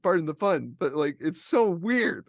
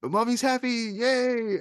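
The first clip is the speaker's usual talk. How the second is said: mock cheer; 8 semitones higher, slower than usual. Mother's forced happy reaction.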